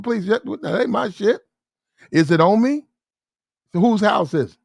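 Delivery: high, incredulous mock protest; indignant denial. Man telling police it isn't his, indignantly incredulous. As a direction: plead innocence with exaggerated disbelief.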